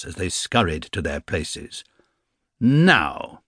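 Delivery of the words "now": pointed, drawn-out sarcastic call; mock the class into silence; teacher's sarcastic call. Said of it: "he called sarcastically".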